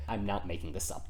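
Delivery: usual speaking voice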